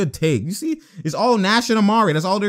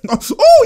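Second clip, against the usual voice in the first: loud laughing oh; 9 dB louder than usual. Real, not acted. Reacting to a lucky play, laughing oh. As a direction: burst out laughing. Watching highlight, big reaction.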